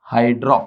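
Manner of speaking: "हाइड्रो" ending in an open "aw", between o and a; blended pronunciation. Shown as a demonstration. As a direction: say "हाइड्रो" as "hai-draw", a mix of hydro and hydra. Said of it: "हाइड्रो और हाइड्रा का mixture".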